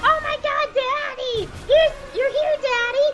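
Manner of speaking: put-on very high squealing little girl voice; excited daughter. Daughter spots her father in the cantina, very high excited squeal. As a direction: squeal it like an overjoyed kid. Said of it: "she blows everyone's cover by going".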